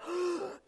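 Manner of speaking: sharp acted gasp of shock. Everyone in the synagogue reacts, gasping in shock. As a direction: suck in air, stunned. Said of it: "gasping in shock".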